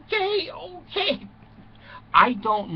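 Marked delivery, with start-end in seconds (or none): 0.0-1.3 high, slow laughing giggle; high tittering delight; giggling okay. Acted. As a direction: squeal it out through laughter. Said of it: "He goes".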